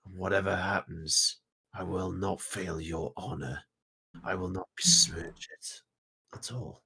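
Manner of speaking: low, slow, deliberate vow; solemn warrior in prayer. Acted. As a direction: swear it to your god, weighing each phrase.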